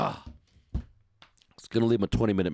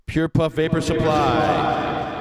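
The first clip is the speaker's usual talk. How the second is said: hyped sponsor read; 7 semitones higher, 6 dB louder, much slower than usual. Acted. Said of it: "I can have some more enthusiasm there".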